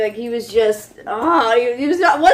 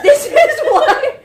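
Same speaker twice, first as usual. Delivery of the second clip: higher, louder, slow laughing shout; mock scream; 12 semitones higher, 5 dB louder, slower than usual. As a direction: blurt it out loud, cracking up. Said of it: "she screams out".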